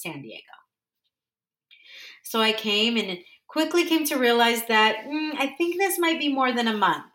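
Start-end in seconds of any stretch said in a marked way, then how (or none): none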